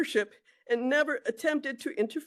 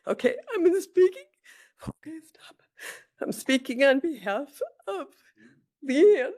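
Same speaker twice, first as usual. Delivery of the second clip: fighting back real tears as she starts; tearful; 3 semitones higher, 4 dB louder than usual. Real, not acted. Aunt speaking for her niece at a hearing, tearful and breaking. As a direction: voice breaking through tears, pitch jumping up. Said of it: "I'm a little emotional".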